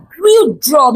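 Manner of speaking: plain reading voice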